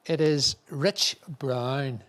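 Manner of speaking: slow, drawn-out winner announcement; dramatic slow raffle reveal. Real, not acted. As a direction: stretch out the name like a big reveal.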